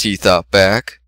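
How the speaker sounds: ordinary delivery, nothing marked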